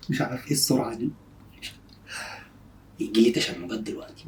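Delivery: very soft, low confiding voice; low undertone; hushed aside. Real, not acted. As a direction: murmur it secretly to the camera. Secret aside during prank.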